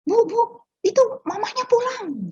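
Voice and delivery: high, excited mock call from another room; high-pitched retold shout that the cat is home; maid calling out. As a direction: call out the news, imitating the maid's excited cry. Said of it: "si Mbak itu teriak-teriak".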